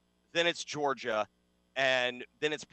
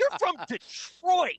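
high, slow, put-on British accent; mock British accent; 13 semitones higher, 4 dB louder, slower than usual. Mocking [name]'s sudden British accent. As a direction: ham up a fake British accent. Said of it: "[name] had a British accent".

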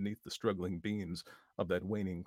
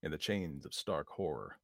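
plain reading voice

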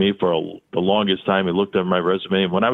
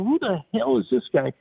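mock bewildered; 14 semitones higher than usual. Startled by intruder.

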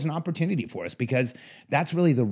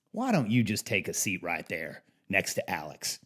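lightly put-on Southern accent, lower and softer; 5 semitones lower, 5 dB quieter than usual. Client telling a visitor to take a seat, attempted Southern accent. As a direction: attempt a polite Southern man, pitched down. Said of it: "I'm doing my best Southern accent".

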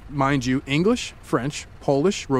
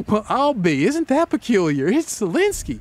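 higher, chirpy Southern amazement; mock-cheery Southern wonder; sunny Southern aside; 6 semitones higher than usual. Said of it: "pantomime in your sunny way".

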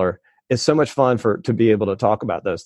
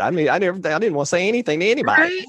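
higher, quicker excited confession; animated; 8 semitones higher, faster than usual. Real, not acted. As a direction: rush it out pitched up. Admits early shyness.

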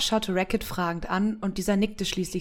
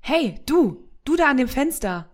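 calling out; 5 semitones higher, 5 dB louder than usual. Acted. Samira calls to boy at window.

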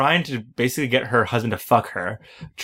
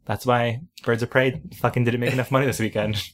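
ordinary talk

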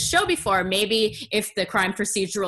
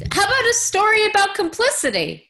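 excited suggestion; 10 semitones higher, 4 dB louder than usual. Real, not acted.

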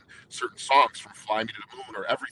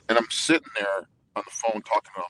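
normal talking voice

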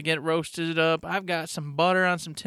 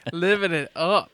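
laughing. Real, not acted. Laughing at joke.

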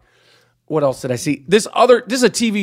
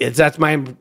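ordinary talk, nothing marked

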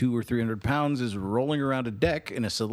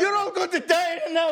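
high British warning shout; hammed-up British crier's shout; mock British accent; 3 dB louder than usual. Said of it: "in a British accent".

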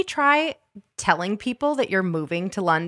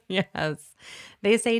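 ordinary talk

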